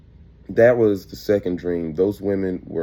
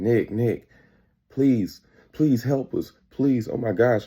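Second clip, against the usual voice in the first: imitating urgent begging, pitched up; retold plea for help; 5 semitones higher, slower than usual. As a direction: plead in a higher voice, mildly acted. Exes run up begging for help.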